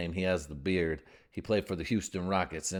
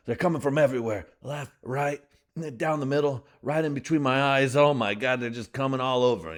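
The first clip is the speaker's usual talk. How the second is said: mock panic; 5 semitones higher, 4 dB louder than usual. Lineman panicking at blitz.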